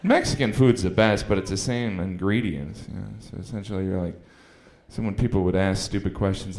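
usual speaking voice

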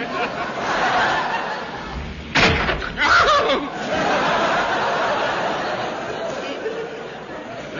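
long loud breathy cackle; hammed-up villain laugh; maniacal laugh.

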